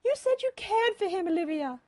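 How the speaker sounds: high, loud wounded accusation; reproachful. A narrator's reading. Mother reminding Olivia of her word, reproachful. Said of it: "Mother said reproachfully".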